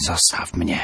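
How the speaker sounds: a touch of an eerie undead hiss; mild monstrous hiss from the reviving corpse. A narrator's reading. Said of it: "syknęła".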